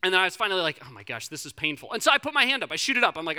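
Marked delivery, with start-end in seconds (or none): 0.8-1.9 low, quiet exasperated mutter; muttered to himself. Retold or imitated. Himself in the awkward silence, a quiet pained mutter. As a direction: grumble it under your breath.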